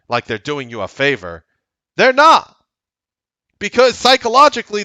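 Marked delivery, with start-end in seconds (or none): 2.0-4.8 sudden shout. Real, not acted. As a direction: shout the denial, much higher and louder. Scolding viewers about scammers.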